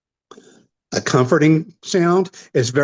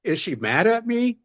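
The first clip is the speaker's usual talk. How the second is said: higher-pitched worried questioning, imitated; mock alarm in a raised voice; alarmed American listener; 7 semitones higher, faster than usual. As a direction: play a taken-aback listener asking warily. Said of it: "it catches their ears as".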